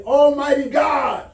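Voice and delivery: high, slow, shouted protest to God; furious man wailing at God; angry outcry. Acted. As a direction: cry out in outrage, dragging the words. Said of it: "he was angry".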